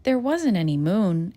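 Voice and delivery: ordinary delivery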